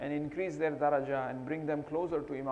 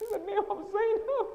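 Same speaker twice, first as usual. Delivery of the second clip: high, cracking sob through the words; sobbing. Real, not acted. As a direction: break down weeping on her name. Preacher weeps mid-sentence.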